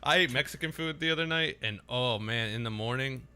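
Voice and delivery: ordinary delivery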